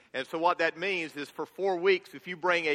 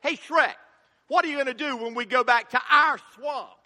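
higher, louder, chirpy sidekick asking; Donkey voice; 7 semitones higher, 5 dB louder than usual. Retold or imitated. Pastor voicing Donkey asking Shrek about their swamp. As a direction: play the eager donkey pal. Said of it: "Donkey asks".

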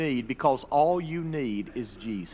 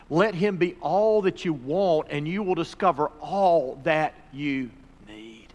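ordinary delivery

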